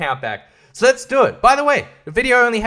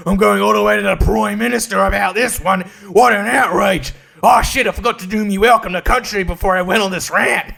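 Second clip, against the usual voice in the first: mock woke commenter. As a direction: parody an indignant online commenter. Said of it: "Facebook comments are also saying".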